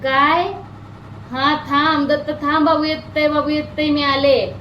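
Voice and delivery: put-on high, slow little-girl voice calling to the god; mother imitating her little daughter talking to the idol, high and childlike; imitating her toddler. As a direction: mimic the child's sing-song 'wait, I'm coming'. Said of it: "तर अशी म्हणणार की".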